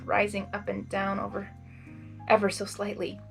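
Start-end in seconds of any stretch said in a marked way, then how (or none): none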